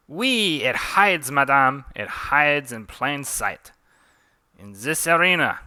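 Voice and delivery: put-on loud, theatrical French accent; emphatic French-accented showman. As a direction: declaim to the arena in mock French. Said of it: "Forgive my French accent".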